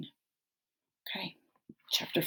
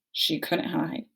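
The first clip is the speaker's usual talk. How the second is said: ordinary delivery, nothing marked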